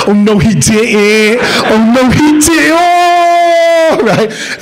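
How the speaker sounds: mock the gossiping onlookers; sassy crowd. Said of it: "A whole group of people popped out".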